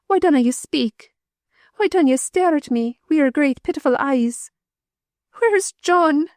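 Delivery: rising from low to high and wild; growing wild. A narrator's reading. Mother demanding news of her husband, growing wild. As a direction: build from a growl to a frantic cry. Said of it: "in a low, growling tone, gathering in wildness".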